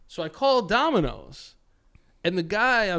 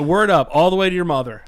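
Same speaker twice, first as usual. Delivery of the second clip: loud, emphatic retold catchphrase; forcefully imitated phone sign-off; exact imitation; 8 dB louder than usual. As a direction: quote him with a punchy raised volume. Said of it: "That's exactly how he said it".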